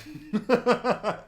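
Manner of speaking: loud high laugh; high burst of laughter. Real, not acted. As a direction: let out a sudden laugh. Laughing at the joke.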